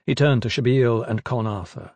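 usual speaking voice

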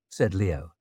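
plain reading voice

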